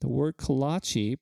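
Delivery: ordinary talk, nothing marked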